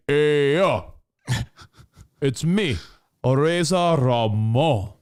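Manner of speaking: slow, cocky tough-guy drawl; mock macho wrestler intro; Razor Ramon impression. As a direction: lean back poolside and own it. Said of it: "They have Razor Ramon poolside".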